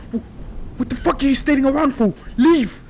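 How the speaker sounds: loud, fast, high yelling; furious shout. Acted. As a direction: bark at them to get out. Bully yelling at friends.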